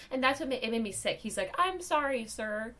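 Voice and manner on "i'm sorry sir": high-pitched apologetic imitation; meek customer-service apology, pitched up; retold apology. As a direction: voice a cowed clerk apologizing. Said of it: "this guy is having to apologize".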